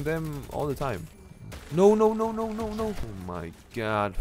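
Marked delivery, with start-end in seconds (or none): 1.7-2.9 high panicked shouting; panicked no's. Real, not acted. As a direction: panic as you get hit. Getting hit in game.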